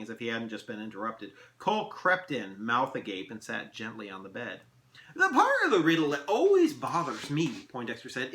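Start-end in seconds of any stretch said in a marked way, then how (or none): 5.1-7.7 fussy elf. Acted. Elf holds forth.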